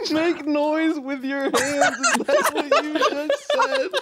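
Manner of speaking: high, slow, laughing question. Real, not acted. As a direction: laugh through the words. Cracking up at the whisper.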